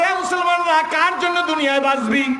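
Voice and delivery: high, ringing shout of summons; calling out. Retold or imitated. Bilal calling Muslims from their sleep, high loud shout. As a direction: cry out to all the Muslims. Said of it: "ডাক দিয়ে বলছে".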